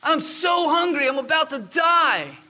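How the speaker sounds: hammed-up wail, drawn out and high; melodramatic starving child. Daughter storms in to tell dad she's starving, melodramatic. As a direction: play up a child's loud, desperate complaint. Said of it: "she stormed into the room".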